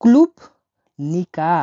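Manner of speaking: "ক্লুবনিকা" drawn out very slowly, syllable by syllable; slow demonstration. Shown as a demonstration. Russian tutor slowly modelling "ক্লুবনিকা" for students. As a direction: stretch "ক্লুবনিকা" out slowly for the class. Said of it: "দেখুন".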